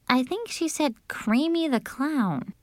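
put-on high-pitched girl's guess; unsure Cinderella. A narrator's reading. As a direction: offer it high, as a tentative guess.

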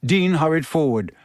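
ordinary delivery

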